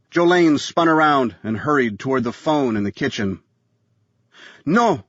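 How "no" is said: high, firm refusal. A narrator's reading. Wife overruling her husband, firm no. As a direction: stop her cold. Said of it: "Sarah said".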